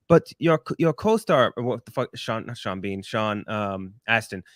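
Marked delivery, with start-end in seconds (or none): 0.1-1.5 mild mock German interviewer. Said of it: "And he's like".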